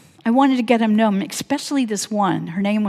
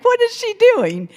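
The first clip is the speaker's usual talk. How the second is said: high-pitched mock hysterics; amused onlookers; 13 semitones higher, 4 dB louder than usual. Women hooting at the stranger on the bed, high mock hilarity. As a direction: imitate onlookers in stitches, voice shooting up. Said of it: "they were laughing hysterically".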